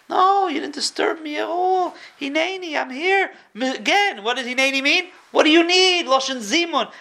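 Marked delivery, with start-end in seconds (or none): 0.1-3.3 higher, gentle father's reply; tender reassurance. Acted. Avraham answers son tenderly. 5.3-6.1 higher and louder, ready to help; eager offer. Acted.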